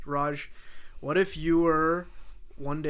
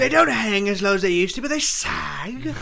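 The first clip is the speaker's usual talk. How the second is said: louder, slowing hard on the last words; British impression; 3 semitones higher, 4 dB louder than usual. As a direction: lean into a mock British actor, dragging out the end. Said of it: "that was like my fucking".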